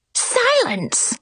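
high sharp yell. A narrator's reading. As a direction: cut them off with a yell. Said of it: "the Queen yelled".

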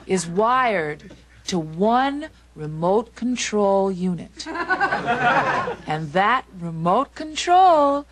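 very slow, teasing, drawn-out reveal; seductive build-up. Acted. Wife promising husband the one remote control, seductive build-up. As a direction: linger on each phrase, swelling at the end.

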